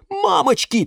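high panicked scream of fright; terrified shout. A narrator's reading. Boy waking to find his plant wilted, screaming. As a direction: yell it in sudden horror. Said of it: "От ужаса он закричал".

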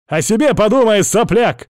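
loud, high parting shout. A narrator's reading. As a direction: yell it back as an insult. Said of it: "крикнул".

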